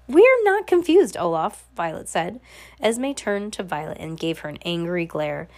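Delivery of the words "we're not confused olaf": indignant retort. A narrator's reading. Violet contradicts the disguised Count Olaf, indignant. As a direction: push each word out high and loud.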